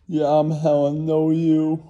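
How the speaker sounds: high, loud snooty boast; rich show-off Helen. Acted. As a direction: play the bragging first-class passenger. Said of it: "Holding court in first class".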